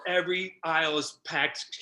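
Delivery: ordinary talk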